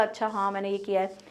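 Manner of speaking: a quiet, lightly voiced child thinking; child's inner thought. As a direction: murmur it like a child admitting a thing to himself. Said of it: "उसे लगेगा".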